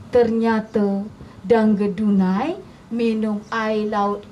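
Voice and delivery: ordinary delivery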